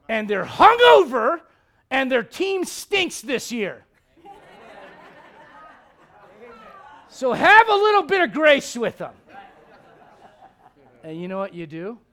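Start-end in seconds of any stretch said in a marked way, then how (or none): 0.1-9.1 high, loud, drawn-out outburst; comic exasperated shout. Acted. Preacher voicing God's plea for grace, comically shouted. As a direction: build to a hammed-up yell, stretching the words. Comic escalation of the Spirit's advice.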